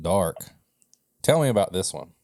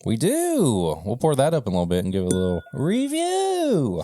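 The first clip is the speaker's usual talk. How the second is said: silly put-on voice; 9 semitones higher than usual. Hamming up a beer review for a co-host, silly singsong voice. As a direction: stretch the line ends into high, held notes. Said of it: "I don't know why I'm talking like that".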